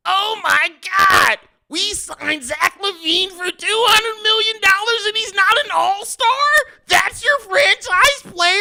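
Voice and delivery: high, loud, hammed-up outrage; whining fan imitation. Bulls fan over LaVine missing all-star, mock wailing. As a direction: wail in disbelief like a distraught fan, ending on a long cry. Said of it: "I'm gonna do one last imitation. Here goes the Bulls fans".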